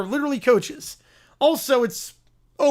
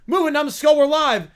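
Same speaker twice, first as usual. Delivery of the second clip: high, loud stooge order; bossy Moe; 6 semitones higher, 7 dB louder than usual. Acted. Moe to tangled stooge on stream, bossy shout. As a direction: shout it urgently at a bungler.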